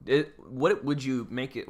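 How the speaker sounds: ordinary delivery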